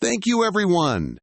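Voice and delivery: bright, high thank-you; light up with delight; happy thanks. Acted. Little girl thanking friends at her birthday, excited. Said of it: "The little girl happily says".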